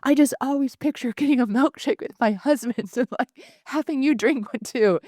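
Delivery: high-pitched upset plea broken by laughs; hammed-up high whine through laughter; mock upset. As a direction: replay your old upset, laughing at yourself. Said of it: "it used to upset me so much".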